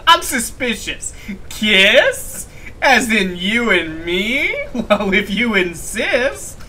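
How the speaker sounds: high, loud, drawn-out mock flirting; hammed-up flirty suspicion. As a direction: play coy, stretching it out slowly. Hammed reply to kiss offer.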